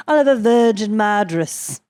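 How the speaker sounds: loud, badly faked British accent. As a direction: order drinks in a hammy fake British accent. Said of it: "like, a bad British accent".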